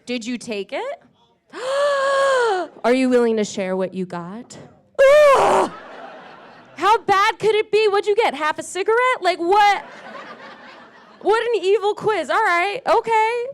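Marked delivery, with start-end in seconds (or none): 1.5-2.8 high, loud mock gasp of shock. Comic reacting to an audience member's quiz result, loud mock gasp. 5.0-5.7 high, loud mock groan of disgust; a shouted hammed-up ugh; mock disgust cry. Comic reacting to a bad quiz result, loud mock groan. 6.8-13.4 higher and louder, worked-up teasing; excited teasing. Acted. Comic grilling an audience member about the quiz, excited.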